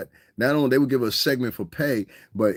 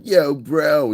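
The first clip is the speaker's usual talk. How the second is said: suburban kid impression; 6 semitones higher, 6 dB louder than usual. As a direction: toss off a hammed-up suburban greeting.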